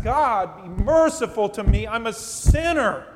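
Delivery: beating his breast, he calls out his confession; loud anguished plea. Retold or imitated. Tax collector pleads loudly to God for mercy. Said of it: "He beats his breast".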